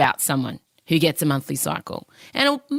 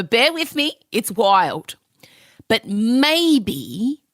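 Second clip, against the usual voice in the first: normal talking voice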